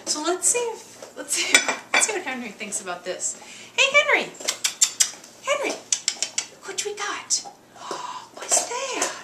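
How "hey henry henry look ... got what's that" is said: very high excited pet talk; calling the dog. Real, not acted. Owner excitedly calling Henry to show a new bone. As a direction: hype him up with a squeaky call and gasp.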